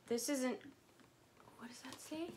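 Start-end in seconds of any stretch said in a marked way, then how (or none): 1.6-2.3 hushed, curious whisper. Real, not acted. Whispering as she reads a brand label. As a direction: whisper it while squinting at the label.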